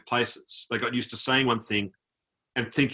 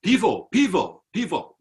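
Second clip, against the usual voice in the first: lightly voiced soldier, higher pitch; imitating eager soldier; 7 semitones higher, 3 dB louder than usual. Young soldiers approach tourists for beer, imitated eager call. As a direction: mimic a soldier's repeated request.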